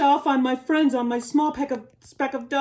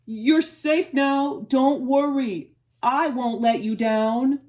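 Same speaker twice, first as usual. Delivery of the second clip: slow, louder, steady reassurance; reassuring Horton; 4 semitones higher, 9 dB louder, slower than usual. Acted. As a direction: promise calmly and firmly.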